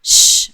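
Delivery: a long hissing sh; श drawn out with breath; sh sound demo. Shown as a demonstration. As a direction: push air out on श. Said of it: "जैसे बोलेंगे".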